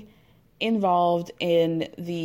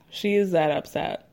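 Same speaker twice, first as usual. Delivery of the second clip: ordinary talk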